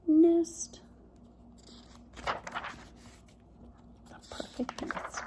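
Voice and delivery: slow, breathy hush on the perfect nest; whisper. Acted. Reader to children, slowly whispering the title. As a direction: draw them in with a whisper.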